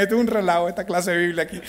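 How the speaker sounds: ordinary talk, nothing marked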